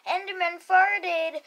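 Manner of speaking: usual speaking voice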